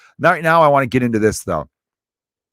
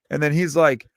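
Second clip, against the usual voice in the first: ordinary delivery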